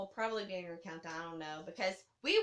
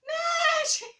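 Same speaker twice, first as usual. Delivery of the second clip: much higher, louder announcing shout; mock magician; 10 dB louder than usual. Retelling the punchline of the stage show, high showy shout. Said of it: "She goes".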